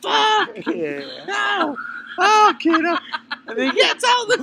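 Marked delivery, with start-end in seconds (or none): 0.0-3.0 higher, rising mock outburst; frantic outburst. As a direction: blurt it out, half losing it. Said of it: "It's like".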